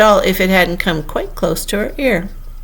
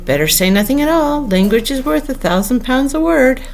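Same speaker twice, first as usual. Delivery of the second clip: thinking chorus; 7 semitones higher than usual. A narrator's reading. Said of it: "they all thought in chorus".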